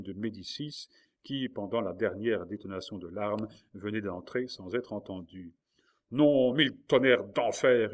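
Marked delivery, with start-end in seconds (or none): 6.1-7.9 loud, slow, heavy curse; furious howl. A narrator's reading. King throwing his arquebus across the room, furious howl. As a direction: roar each word out, raised and drawn out. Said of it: "hurla Charles en jetant son arquebuse".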